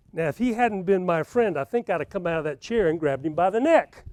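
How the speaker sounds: ordinary delivery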